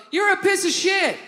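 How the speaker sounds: high-pitched hollered insult; imitated mother's yell. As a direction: holler it out like an angry mom. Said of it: "my mother yells out".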